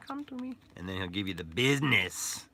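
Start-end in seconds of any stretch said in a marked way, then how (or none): 0.1-0.5 very high squeaky menacing little man; a tiny creep luring you in, high-pitched; high squeaky voice of a sinister little doll; creepy toy voice. Acted. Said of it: "he'll bring you in".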